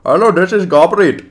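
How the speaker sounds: put-on high, loud Indian-accented phone greeting; hammed-up Indian caller. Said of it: "I'm from India".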